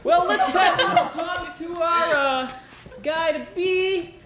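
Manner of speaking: cheesy game show host, upbeat; sleazy charm, playing to the ladies; flirty teasing dating host.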